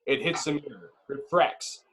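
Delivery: ordinary talk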